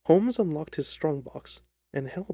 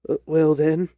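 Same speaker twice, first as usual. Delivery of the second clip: embarrassed, louder little-man voice; flustered hesitation; 8 dB louder than usual. Acted. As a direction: give in awkwardly, caught out. Ryder caught using an alias.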